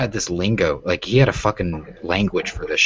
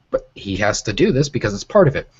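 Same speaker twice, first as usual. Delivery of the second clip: ordinary delivery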